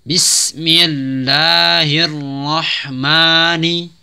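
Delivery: very slow melodic recitation of Bismillahirrahmanirrahim; Quran recitation. Shown as a demonstration. Teacher models full recitation, slow melodic chant. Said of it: "Kemudian ar-rahim".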